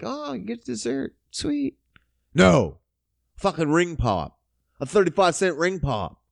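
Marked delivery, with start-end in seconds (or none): 0.0-1.8 soft, higher mock delight; sarcastic fake delight. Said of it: "And I'm like". 2.3-2.8 a short louder blunt no; sharp loud no. Real, not acted. Rejecting the ring pop.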